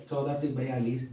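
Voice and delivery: normal talking voice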